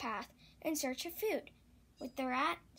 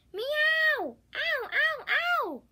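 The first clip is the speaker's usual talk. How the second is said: high, loud cat yowl of pain; yowling cat cry; 10 dB louder, much slower than usual. Acted. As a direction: wail like a stung cat. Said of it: "cried the fat cat".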